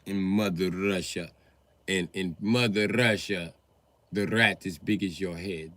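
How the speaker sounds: lightly accented Mother Russia, slowed on the repeat; Russian accent. Shown as a demonstration. Comic telling of Russia, in a light Russian accent. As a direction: announce it in a hint of a Russian accent.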